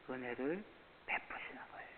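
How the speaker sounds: whispered sermon line, voice dropped away. Acted. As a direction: let it fall to a hush for the congregation. Sermon drops to a hush. (속삭이듯 낮춰 말하는 설교)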